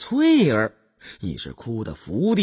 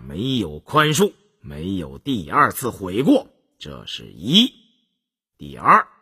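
low, deliberate lord laying down the law; a strict master, cold and firm; stern prince; 7 semitones lower than usual. A narrator's reading. Prince lists his rules.

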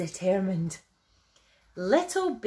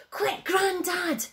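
high, excited call; excited little bear's mild yell; urgent yell; 10 semitones higher than usual. A narrator's reading. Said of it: "he yelled".

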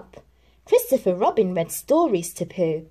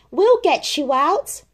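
calling out; 8 semitones higher, 5 dB louder than usual. Acted. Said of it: "called from the ground".

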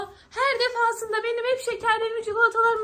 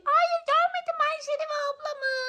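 Niloya; 6 semitones higher than usual. Pretend. Toy Niloya answers that she hasn't seen big sister, high pretend voice. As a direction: answer as a small squeaky character. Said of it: "Nilo'ya gördün mü Ayşe Reva'yı?".

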